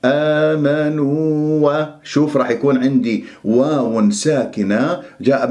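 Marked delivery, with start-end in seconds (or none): 0.1-1.6 آمنوا slowly with a held long u; two-beat elongation. Shown as a demonstration. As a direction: stretch the vowels of آمنوا evenly. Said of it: "مد التمكين حركتين".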